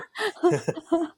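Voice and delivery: a very high, giggling laugh; can't stop giggling. Real, not acted. Still laughing at the static joke, a high giggle.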